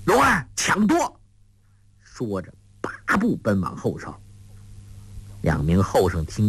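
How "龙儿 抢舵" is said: high-pitched urgent shout; loud call. A narrator's reading. Guo Jing shouts for Rong'er to seize the rudder, loud call. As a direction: call out a sharp order, much higher. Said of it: "高声叫道".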